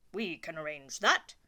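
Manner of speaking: a king settling matters. Acted. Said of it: "said the king".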